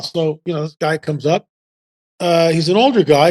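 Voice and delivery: ordinary talk, nothing marked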